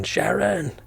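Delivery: a light mock Australian call; playful Australian drawl, slow; Australian accent. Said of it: "like, the Australian one".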